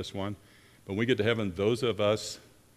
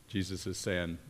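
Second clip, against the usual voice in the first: usual speaking voice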